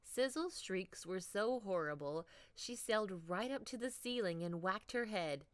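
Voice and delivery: ordinary delivery, nothing marked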